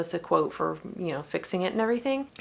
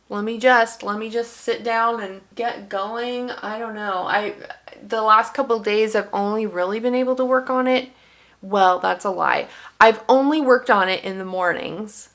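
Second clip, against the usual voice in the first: ordinary talk, nothing marked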